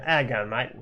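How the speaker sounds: broad ocker Australian greeting; Aussie ocker accent. Shown as a demonstration. Guest shows off his accent to the host, broad Aussie ocker. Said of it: "real Aussie Ocker accent".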